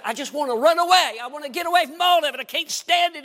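high, urgent plea to escape; desperate. Retold or imitated. David longs to flee his troubles, acted desperation. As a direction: cry out like a man who can't cope.

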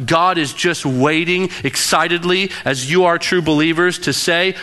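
ordinary talk, nothing marked

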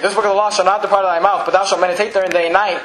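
brisk run through the verse; quick re-reading. Real, not acted. Preacher re-reading after correcting the verse number, brisk. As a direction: rattle through the scripture without lingering.

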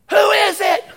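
high, loud, drawn-out call; acted shout at a knock; yelling to the door. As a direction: holler it across a noisy house. Household yells at knock on door.

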